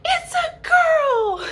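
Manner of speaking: very high, drawn-out announcement; excited yell. Retold or imitated. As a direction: imitate an aunt shrieking the news, stretched out. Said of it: "I heard you yell it".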